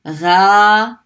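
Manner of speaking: Gha said loud from the back of the throat, gargled; Arabic ghain sound. Shown as a demonstration. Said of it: "this is the letter ghain".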